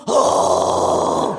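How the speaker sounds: deep, loud disapproving oh; a big mock gasp of disbelief. Mock reaction to not drinking.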